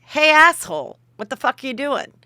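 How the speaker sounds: loud, high indignant call-out; acted-out angry challenge, punched at the start; mock confrontation.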